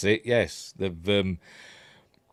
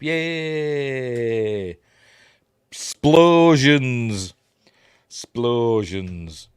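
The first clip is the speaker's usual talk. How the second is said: gleeful cheer; 3 semitones higher, 5 dB louder, much slower than usual. Real, not acted. Cheering the blast on screen.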